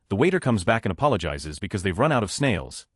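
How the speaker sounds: ordinary delivery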